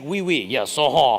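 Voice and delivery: put-on a touch of a French accent, loud on the uh-huh; lightly voicing the French priest agreeing. Visiting French priest answers Jason, light French accent. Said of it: "he was visiting from France".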